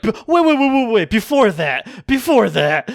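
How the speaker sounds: high loud mock interruption; squeaky imitation of a frantic friend; mocking high excited voice. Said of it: "And you're like".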